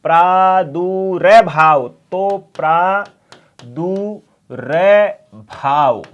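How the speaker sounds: प्रादुर्भाव drawn out very slowly; slow demo. Shown as a demonstration. Teacher slowly pronouncing प्रादुर्भाव for class. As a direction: sound out प्रादुर्भाव syllable by syllable. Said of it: "बीच में रे का उच्चारण".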